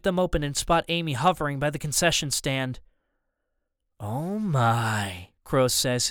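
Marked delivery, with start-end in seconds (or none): 4.0-5.3 low, drawn-out mock amazement; low and menacingly amused; villain's taunt. A narrator's reading. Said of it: "his voice carrying in the silence".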